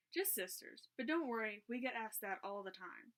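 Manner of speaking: plain reading voice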